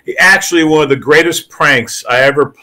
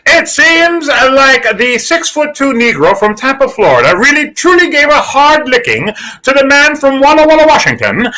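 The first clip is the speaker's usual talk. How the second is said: high, booming sportscaster voice; over-the-top ABC sports announcer; Keith Jackson impression; 9 semitones higher than usual. Shown as a demonstration. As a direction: call it like an old network play-by-play man. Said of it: "I would do something like that".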